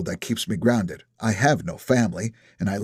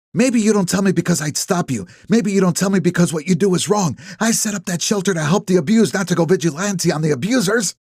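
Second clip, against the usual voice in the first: Ellie voiced higher, indignant and pressing; angry accusation; 8 semitones higher than usual. A narrator's reading. Ellie confronts Nap in the driveway, higher, indignant and pressing. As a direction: push him hard on the line he crossed.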